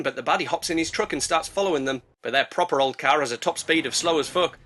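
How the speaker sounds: ordinary talk, nothing marked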